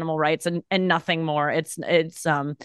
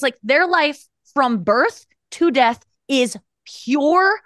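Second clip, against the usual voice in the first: ordinary talk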